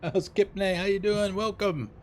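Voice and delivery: high, bright greetings; cheerful greeting. Real, not acted. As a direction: greet chat with delight.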